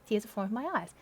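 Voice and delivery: ordinary delivery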